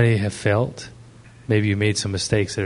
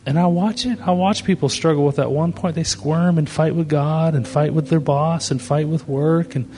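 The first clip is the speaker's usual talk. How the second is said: fervent preaching; 7 semitones higher than usual. Acted. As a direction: drive the point home to the congregation. Preaching at full force.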